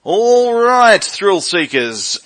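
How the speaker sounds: big, loud show-host opener; announcer voice. Real, not acted. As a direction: boom it out like a radio host. Host opens new interview segment.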